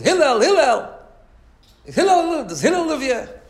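loud, high shout for Hillel; calling out. Retold or imitated. Man baiting Hillel shouts for him at his house, loud and high. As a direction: yell his name at the door, impatient.

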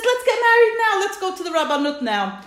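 loud, high, impatient suitor in retelling; urgent high-pitched push to go now; very eager, excited. Retold or imitated. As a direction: act out a groom who can't wait. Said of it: "he's very eager and he's very excited".

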